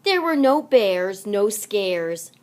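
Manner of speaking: usual speaking voice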